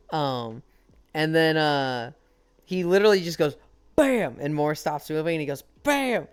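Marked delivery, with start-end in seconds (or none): 4.0-4.4 loud, high "bam"; punchy imitation of a blow landing; punch sound effect. Narrating a game fistfight, exclaimed punch effect. 5.8-6.3 high-pitched exclaimed "bam"; punch sound effect. Retold or imitated. Narrating a game fistfight, high punch effect.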